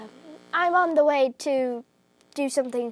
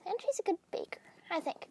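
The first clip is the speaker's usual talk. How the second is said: hushed aside; whispering; 5 semitones higher, 12 dB quieter, faster than usual. Acted. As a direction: whisper it like a secret. Gossipy aside about Moth.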